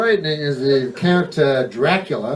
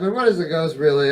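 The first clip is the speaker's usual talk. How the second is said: ordinary talk, nothing marked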